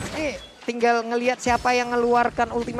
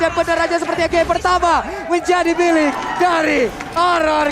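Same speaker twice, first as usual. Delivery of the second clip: loud, higher hype call; hyped shouting; 4 semitones higher, 3 dB louder than usual. Real, not acted. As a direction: shout the win like an announcer. Caster calling the game win.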